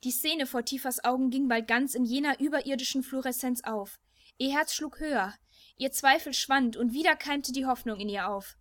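ordinary delivery, nothing marked

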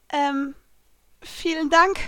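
politely unconvinced; half-hearted. A narrator's reading. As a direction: thank them uncertainly, after an awkward pause. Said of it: "sagte ich halbherzig".

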